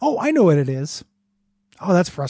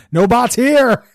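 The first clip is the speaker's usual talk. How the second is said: high, loud robot voice; toy robot voice; 7 semitones higher, 5 dB louder, much slower than usual. Shown as a demonstration. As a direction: announce it like a bot.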